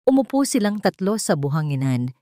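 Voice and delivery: plain reading voice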